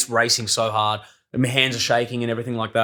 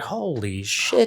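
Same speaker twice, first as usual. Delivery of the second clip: slow, hushed awe; retold stunned reaction, stretched out; 5 dB quieter, much slower than usual. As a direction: draw it out quietly in disbelief.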